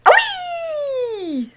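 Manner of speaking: high shrill squawk of a startled hen; hen screech. A narrator's reading. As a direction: screech like a hen shot upward. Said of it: "screeched the hen".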